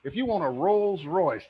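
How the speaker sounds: ordinary delivery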